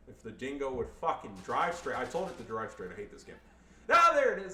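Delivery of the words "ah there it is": high, loud burst of relief; loud outburst. Real, not acted. Player seeing the unit finally drive straight, loud outburst. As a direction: cry out as it finally works.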